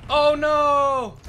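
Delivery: high loud alarm; alarmed cry. Real, not acted. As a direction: cry out as it goes wrong. Gamer sees danger coming.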